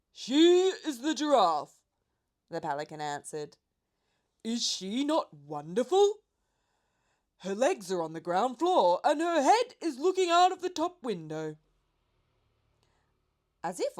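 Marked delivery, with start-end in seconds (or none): 0.1-11.6 loud, high bird voice; proud pelican. A narrator's reading. Pelican proudly introduces the giraffe to the boy. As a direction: boast about a wonderful friend.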